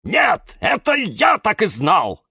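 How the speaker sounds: high, loud angry bark. A narrator's reading. Cactus snaps back at Юра, high angry bark. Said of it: "рявкнул кактус Кирилл".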